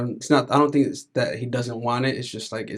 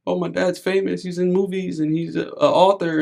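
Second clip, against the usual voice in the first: put-on higher-pitched child's brag; imitated bragging daughter; 7 semitones higher, 4 dB louder than usual. Daughter at school telling class about famous dad, bragging. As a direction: voice a little girl showing off, pitched up.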